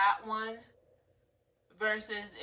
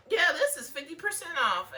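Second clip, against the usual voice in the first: lightly acted salesperson, higher and louder; retold sales pitch; 7 semitones higher, 9 dB louder than usual. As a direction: pitch it loud and high to a customer. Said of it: "I used to tell people the wrong sales".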